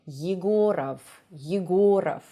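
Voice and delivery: "Yegorov" starting with a y glide and short vowel; unstressed ye onset. Shown as a demonstration. Teacher correcting the actor's name, clear y onset. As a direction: open "Yegorov" on a light "yi". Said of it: "it should sound as ye".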